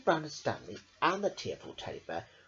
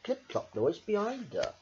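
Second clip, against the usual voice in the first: usual speaking voice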